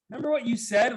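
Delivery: high, pointed reminder; mock wife asking knowingly; wife ribbing him about his boast; wife's teasing reminder. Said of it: "My wife's like".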